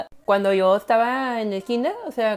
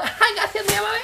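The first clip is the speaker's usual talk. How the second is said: high sweet thanks; 11 semitones higher than usual. Acted.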